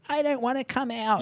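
high, small mock-whine; imitated child tantrum. Evil spirit refusing to leave, imitated childish tantrum. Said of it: "evil spirit's having a little tantrum".

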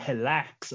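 "Relax" said as "helax"; imitated Brazilian "Relax", starting with a breathy H sound. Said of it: "he was saying".

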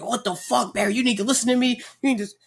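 high, nagging lecture; mimicking Barry.

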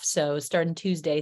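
normal talking voice

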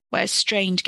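ordinary delivery, nothing marked

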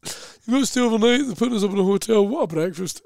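imitated booker relishing the perks; food-obsessed gig booker. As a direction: sell the food like a keen promoter. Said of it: "it's how he'd always talk about gigs".

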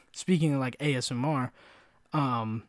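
normal talking voice

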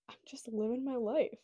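usual speaking voice

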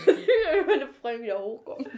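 repeating the line, cracking up; laughing. Real, not acted. Lacht, wiederholt lauter. (lachend lauter)

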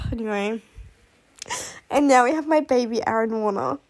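ordinary talk

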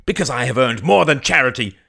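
high, loud, indignant cry; proud shouted retort. Acted. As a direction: declare it forcefully.

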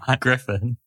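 holding back a laugh; trying not to laugh; stifled giggles. Real, not acted. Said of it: "I can hear you trying not to laugh".

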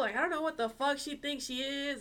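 high-pitched, heated retold rant; going off on him. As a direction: rant indignantly, pitch pushed right up. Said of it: "went off on him".

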